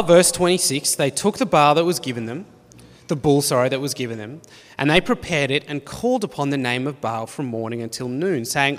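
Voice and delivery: usual speaking voice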